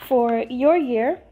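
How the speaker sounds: normal talking voice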